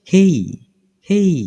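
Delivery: হেই as aspirated "khey"; correct letter pronunciation. Shown as a demonstration. Teacher drilling the letter K, aspirated pronunciation. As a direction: say হেই twice, puffed K and a glide. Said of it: "কে উচ্চারণ কি শিখলাম?".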